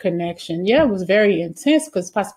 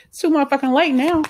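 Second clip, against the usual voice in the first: higher, louder mock snap; angry retort; 8 semitones higher, 4 dB louder than usual. Reader snapping back at the ex who says sorry, angry. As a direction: cut the apology off hard.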